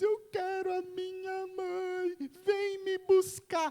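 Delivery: high-pitched, drawn-out mock wail; hammed-up tearful whine, stretched and rising in volume; crying child. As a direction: imitate a little girl bawling for mother down the phone. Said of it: "Bela pega o telefone chorando e diz".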